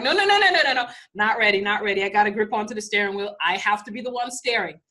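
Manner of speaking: grab the wheel in a frantic panic; panicked refusal. Acted. Humans refusing life's autopilot, panicked loud protest.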